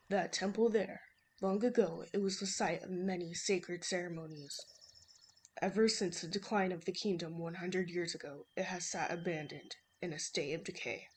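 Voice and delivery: low-voiced old man; man imitation. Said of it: "my horrible man imitation".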